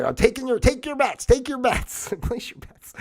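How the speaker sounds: high bookie calling out bets; mock betting-booth caller. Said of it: "let's say".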